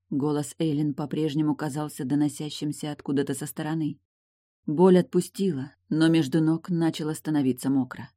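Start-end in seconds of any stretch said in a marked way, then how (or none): none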